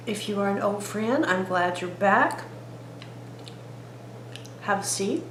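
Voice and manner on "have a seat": normal talking voice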